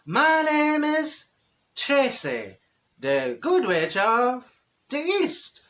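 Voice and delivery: slow, sing-song fairy witch voice; lightly hammed-up good witch announcing herself; good witch greeting. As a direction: float it out like a pantomime good witch. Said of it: "you come down in your bubble and you go".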